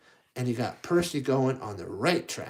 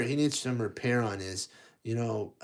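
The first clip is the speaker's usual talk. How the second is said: plain reading voice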